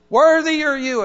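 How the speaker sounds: loud, high cry of praise, as the saints would exult; rejoicing praise. Acted. Saints and angels praising God in the sermon, jubilant loud cry. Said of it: "the saints and angels rejoice and say".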